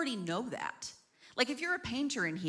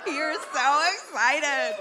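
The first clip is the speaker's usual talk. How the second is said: slow, high, loud mock enthusiasm; mock excited; 7 semitones higher, 7 dB louder, much slower than usual. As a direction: ham up the excitement.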